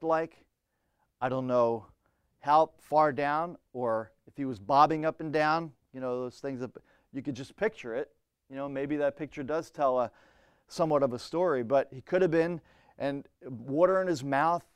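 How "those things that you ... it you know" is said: usual speaking voice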